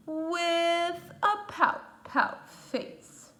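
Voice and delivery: slow, drawn-out sad moan; gloomy fish refrain. Acted. The pout pout fish tells the sea his gloomy refrain, slow and sad. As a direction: moan it out slowly like a sulky fish.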